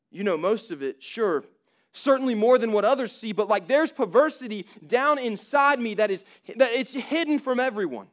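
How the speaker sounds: the sinner insisting he is unworthy; pleading sinner. Acted. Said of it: "dialogue between a person".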